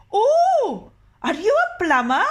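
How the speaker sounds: put-on high, loud old woman voice; a high crone surprised at a visitor. The old woman asks the doctor if he is a plumber, high and loud.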